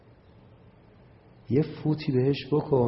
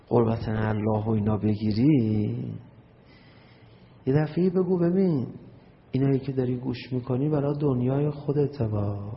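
ordinary talk

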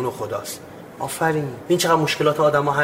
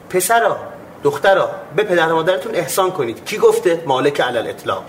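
normal talking voice

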